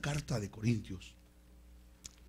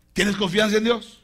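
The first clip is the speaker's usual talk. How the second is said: raised, higher-pitched question; firm challenge; 6 semitones higher, 8 dB louder than usual. Acted. Preacher testing the believer's trust in God, firm challenge. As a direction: put the question loudly and firmly.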